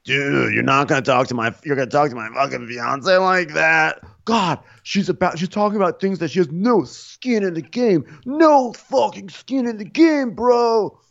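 high mock outrage, rising as it goes; worked-up, drawn-out protest; indignant. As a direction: imitate an affronted fiance having words. Acted quarrel defending fiancee.

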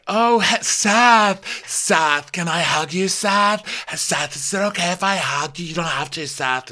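high, pleading put-on voice; hammed-up high pleading, easing off at the end; Lisa voice. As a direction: imitate a needy, high-pitched plea. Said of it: "So Lisa's like".

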